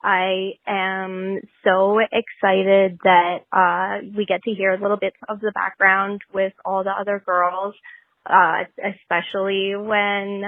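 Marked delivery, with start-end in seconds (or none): none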